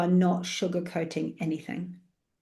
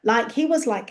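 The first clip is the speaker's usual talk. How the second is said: normal talking voice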